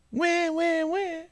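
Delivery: put-on high wah wah baby wailing; mock baby cry. Mom imitating a baby crying, high mock wail. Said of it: "I was taught by my mom".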